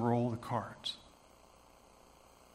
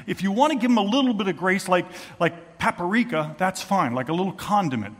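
agitated warning; 5 semitones higher, 7 dB louder than usual. Retold or imitated. Judaizers warn Paul, agitated.

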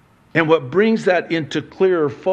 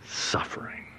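whispered single word; breathy hushed reveal; 12 dB quieter than usual. Acted. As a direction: drop to a hush to land it. Answers his own question, hushed.

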